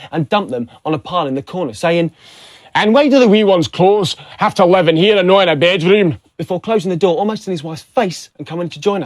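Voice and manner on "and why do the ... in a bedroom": loud, higher Scottish complaint; moaning Scottish husband. Acted. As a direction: keep grumbling at your wife in broad Scots. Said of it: "while moaning at his wife".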